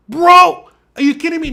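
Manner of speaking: loud, high disbelief; reenacted outraged shout; incredulous yell. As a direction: cry out at the dog in disbelief. Reacting to dog attack.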